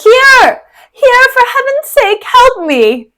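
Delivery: high loud call; cry for help. Acted. Wounded Cassio calling the others over, loud. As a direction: shout to be found.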